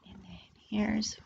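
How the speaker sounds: lively, sing-song picture book reading; reading to children. Acted. Storyteller reading a bee picture book to young children, animated and sing-song. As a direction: read it to the little ones like a storyteller.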